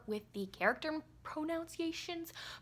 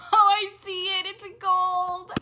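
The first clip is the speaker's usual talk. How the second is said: high, loud thrilled squeal over the book; excited squeal; 8 semitones higher, 12 dB louder than usual. Real, not acted. Squealing at the first glimpse of the gold book. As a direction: gush with delight, much higher and louder. Unboxing the long-awaited book.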